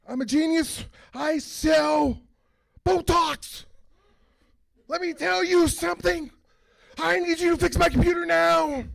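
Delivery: high, loud mocking of a snooty client; a bratty rich client barking orders; entitled client voice. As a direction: play the arrogant sales rep. Voicing entitled pharma sales reps.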